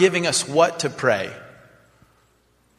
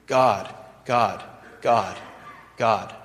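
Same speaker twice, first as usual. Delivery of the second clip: low reverent God; 6 semitones lower, 3 dB quieter, slower than usual. Retold or imitated. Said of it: "first start here".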